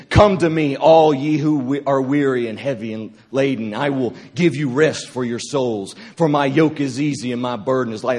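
retold fiery prophetic word; prophetic declaration. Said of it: "Prophetic anointing came on me".

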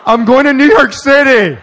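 loud, high, laughing objection; laughing protest. Retold or imitated. Preacher retelling his protest to God about his cash, loud and laughing. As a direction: push back loudly, half joking.

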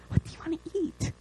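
quick, tempting whisper; the enemy as a tiny whispering mouse; little mouse whisper. Pretend. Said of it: "like a little mouse being like".

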